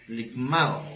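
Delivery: "Ligmao" said slowly as lig-MA-o with the stress on the A; teaching "Ligmao" as lig-MA-o, stressed on the A; Greek word ligmao. Shown as a demonstration. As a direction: spell out "Ligmao" with a firm accent on the A. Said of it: "Acento en la A".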